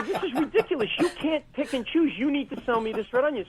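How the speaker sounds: high, exasperated mock rant; mock apoplectic. Comic quoting his rant at the cashiers, mock outrage. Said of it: "So now I'm apoplectic".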